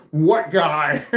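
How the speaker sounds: big loud call-out, spoiling for a fight; booming mock challenge; imitated belligerent challenge. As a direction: imitate a drunk friend loudly looking for trouble. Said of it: "when he said, "What guy?" like that".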